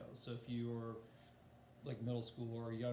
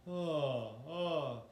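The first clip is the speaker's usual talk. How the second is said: falling pitch slides; 3 semitones higher, 5 dB louder than usual. Shown as a demonstration. Teacher shows a vocal warm-up, sliding down on ah. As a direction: slide each ah downward. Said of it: "So we'll just do".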